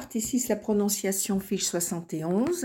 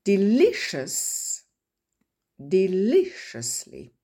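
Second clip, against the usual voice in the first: Delicious then deliciously, slow and falling; slow falling word-stress demo; careful stress model; 2 semitones higher, 3 dB louder, slower than usual. Shown as a demonstration. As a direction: model Delicious slowly, ending on a fall. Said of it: "enregistrer la musique du mot".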